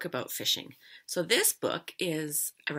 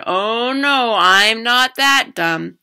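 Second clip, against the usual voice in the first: loud, drawn-out, higher-pitched denial; knowing refusal; 6 semitones higher, 17 dB louder, much slower than usual. A narrator's reading. Girl turning down popcorn bait, knowingly emphatic. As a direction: sing out the refusal, long and emphatic.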